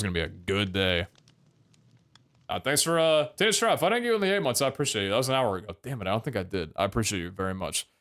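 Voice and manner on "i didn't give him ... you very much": ordinary talk, nothing marked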